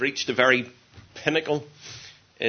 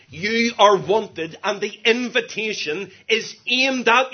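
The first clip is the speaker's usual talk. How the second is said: impassioned preaching; 4 semitones higher, 6 dB louder than usual. Real, not acted. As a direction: press the invitation home, loud and emphatic. Preaches at full force.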